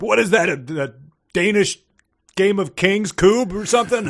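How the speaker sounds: high, loud sneering mock question; mock indignant boss. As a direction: sneer at the strange lawn game. Mocking the boss's complaint.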